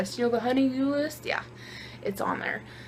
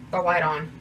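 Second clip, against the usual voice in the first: ordinary delivery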